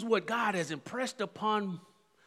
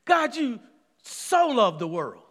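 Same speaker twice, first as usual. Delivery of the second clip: excited; 3 semitones higher, 5 dB louder than usual. Retold or imitated. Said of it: "Man, I got excited when I saw it".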